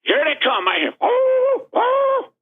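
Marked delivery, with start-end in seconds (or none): 1.0-2.3 much higher mock wail of dismay; a light cry of dismay, pitched way up. Brother sees his dog shot, in high dismay.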